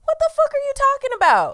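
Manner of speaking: high, loud exasperated demand; indignant outburst. Pretend. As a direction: rant at him in disbelief. Ranting at billionaire as if addressed.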